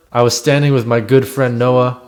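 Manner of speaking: normal talking voice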